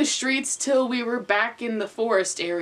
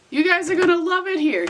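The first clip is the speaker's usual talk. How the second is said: bright, fast and higher; cheerful Naruto; 7 semitones higher, faster than usual. Acted. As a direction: chirp it out cheerfully. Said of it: "with a smile".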